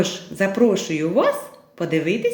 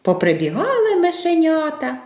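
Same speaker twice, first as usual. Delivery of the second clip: plain reading voice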